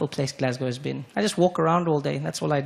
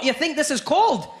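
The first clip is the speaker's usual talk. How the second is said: put-on high Scottish accent, scoffing; a Glaswegian mocking the cold; Scottish local; 11 semitones higher, 3 dB louder than usual. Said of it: "He said".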